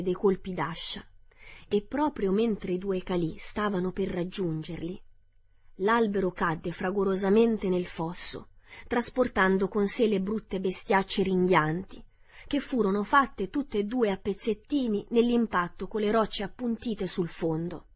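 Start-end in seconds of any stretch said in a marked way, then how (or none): none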